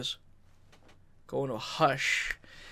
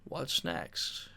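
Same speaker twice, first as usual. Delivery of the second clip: calm inner voice; 12 dB quieter, much slower than usual. Retold or imitated. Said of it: "I just knew".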